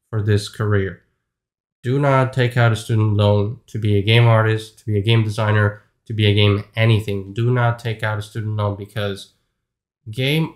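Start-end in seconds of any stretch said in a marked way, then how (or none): none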